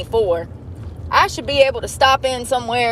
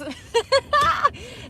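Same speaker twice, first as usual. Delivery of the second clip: high laugh; 14 semitones higher than usual. Real, not acted. Laughing at no debit cards.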